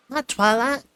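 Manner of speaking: high, loud, happy filly squeak. A narrator's reading. Little filly squeaking hello to her friend, happily. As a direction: squeal the name out with delight. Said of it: "Apple Bloom squeaked happily".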